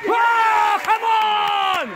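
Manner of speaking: loud high yell of disbelief; outraged shout. Real, not acted. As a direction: yell it at the ref. Outraged at a decision.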